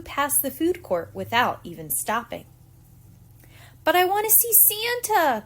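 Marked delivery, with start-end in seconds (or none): 3.8-5.4 put-on high whining little boy; whiny child. A narrator's reading. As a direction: whine like a tired kid at the mall. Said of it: "Patrick said with a whine".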